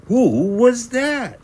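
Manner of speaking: high, loud and slow startled question. A narrator's reading. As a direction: ask it amazed at the mirror.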